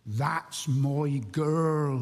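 put-on slow, drawn-out Wiltshire accent. Old boy proud of his wife Lily, slow Wiltshire accent. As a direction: imitate an old Wiltshire man, each word stretched out. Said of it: "in his Wiltshire accent".

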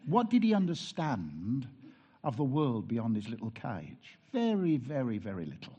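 ordinary talk, nothing marked

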